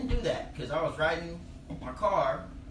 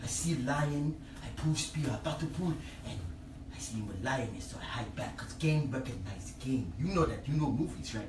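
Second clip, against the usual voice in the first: African storyteller; 4 semitones lower, 5 dB quieter than usual. Retold or imitated. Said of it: "old people World War Two stories".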